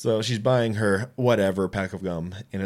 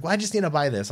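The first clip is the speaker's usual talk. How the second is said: high indignant whine; whiny customer; 8 semitones higher, faster than usual. Retold or imitated. Angry customer insisting, high whine. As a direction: play the offended shopper, higher. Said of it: "She's like".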